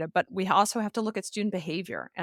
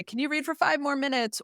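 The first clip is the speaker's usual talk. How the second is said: put-on higher, eager kid begging for more; a child begging the teacher to keep going; pleading student; 7 semitones higher than usual. Student begging the teacher to keep reading, eager higher plea. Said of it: "Or they say".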